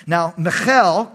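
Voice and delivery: "Michal" said slowly as mə-KHEL with a throaty kh; "Michal" with a guttural Hebrew ch, stress on the end; "Michal" as mee-KHAL, throat sound in the middle; Hebrew pronunciation. Shown as a demonstration. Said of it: "you gotta say it that way".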